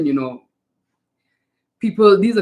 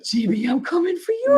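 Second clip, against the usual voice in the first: mock threat; 12 semitones higher than usual.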